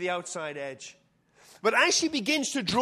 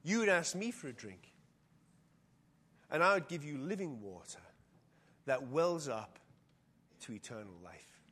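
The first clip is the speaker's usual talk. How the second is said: soft promise; 7 semitones lower, 6 dB quieter than usual. Acted. Jesus promises living water, softening.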